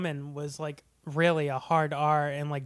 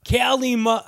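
higher Cockney-accented "Kalima"; 6 semitones higher, 4 dB louder than usual. Shown as a demonstration. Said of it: "I'm trying to do his Cockney accent".

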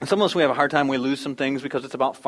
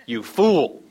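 louder teasing jab; buddy's mock jeer; 5 dB louder than usual. A buddy teasing him over a robotic dog, mock jeering. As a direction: toss it like a friend ribbing you. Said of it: "not one of his buddies that says".

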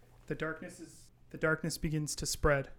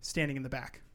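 ordinary talk, nothing marked

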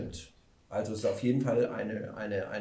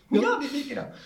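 high, amused echo; laughing; 5 dB louder than usual. Real, not acted. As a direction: echo the name with a chuckle. Co-host echoes teasingly.

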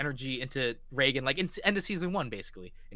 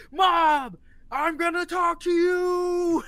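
very high, loud, stretched yell; high slow shout; 7 dB louder, much slower than usual. Retold or imitated. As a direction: scream it while charging. Voicing Reagan charging in.

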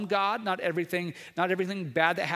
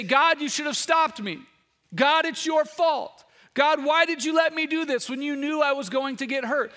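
higher, louder accusing cry at God; congregation's voice blaming God, higher and louder; whining complaint to God; 9 semitones higher, 6 dB louder than usual. Retold or imitated. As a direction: wail it like a blaming child. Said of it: "We say".

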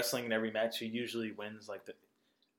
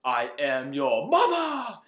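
slow loud dramatic line; mock Vader; 7 dB louder, much slower than usual. Retelling Thumb Wars to co-host, slow dramatic declaration. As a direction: declare it like a movie villain. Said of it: "he's like".

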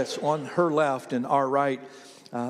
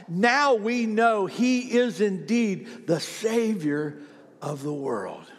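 slow, loud-voiced congregational reading, dropping at the close; emphatic scripture reading; 7 semitones higher, 3 dB louder, slower than usual. Real, not acted. Preacher reading scripture for the congregation to read along, slow and emphatic. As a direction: read it out slowly for all to join in.

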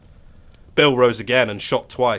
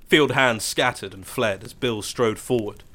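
ordinary delivery

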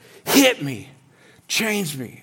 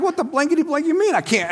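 fast, higher mock outrage; mock indignant retort; 8 semitones higher, faster than usual. Young smoker to seminary staff, mock outrage. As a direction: fire back in hammed-up indignation, fast. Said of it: "I'm like".

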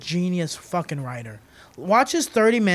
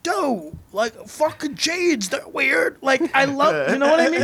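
goofy dude voice; 8 semitones higher, 5 dB louder than usual. Retold or imitated. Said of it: "a guy just like".

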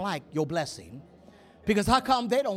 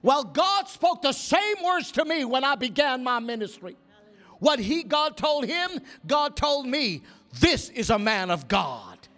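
high, raised revival-preacher cry, slowing to the end; booming preacher proclamation; 6 semitones higher, 3 dB louder than usual. Retold or imitated. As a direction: proclaim it to the room as an imitated evangelist. Imitating Roberts's booming proclamation.

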